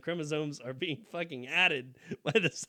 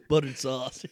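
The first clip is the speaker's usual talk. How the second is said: Gary Busey impression; 6 dB louder than usual. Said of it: "love how he says it".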